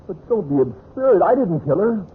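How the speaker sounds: high-pitched, alarmed denial; indignant protest. Acted. Husband denying to Bob that he killed her, indignant. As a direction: protest innocence with rising alarm.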